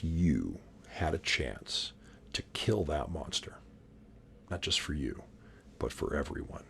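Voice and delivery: low dramatic whisper; hushed whisper. Acted. Host whispering to viewers about killing the monster. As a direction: draw them in with a secret.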